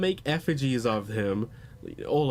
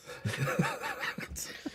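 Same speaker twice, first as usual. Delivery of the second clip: high squealing laugh; 9 dB quieter than usual. Real, not acted.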